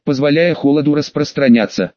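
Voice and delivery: ordinary delivery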